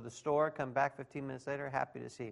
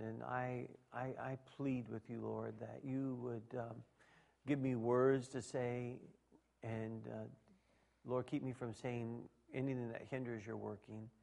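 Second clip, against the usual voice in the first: prayer; 2 semitones lower, 5 dB quieter than usual. Acted. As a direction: plead quietly with the Lord. Pastor prays before the sermon.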